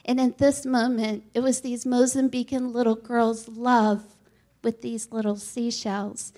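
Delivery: ordinary delivery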